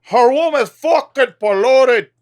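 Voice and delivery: high and loud, put-on voice; mock character voice. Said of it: "That's how I get into character".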